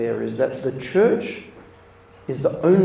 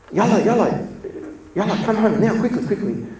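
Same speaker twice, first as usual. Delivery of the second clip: higher, louder urgent calls; frantic wife on phone; 8 semitones higher, 4 dB louder than usual. Retold or imitated. Wife ringing husband about feverish son, urgent and loud. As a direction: hurry him home in a panic. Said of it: "his wife rang him".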